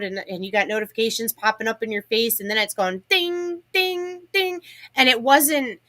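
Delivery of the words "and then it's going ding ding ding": rising high dings like a phone; ding imitation. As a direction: chime out each ding. Mimicking the notification pings.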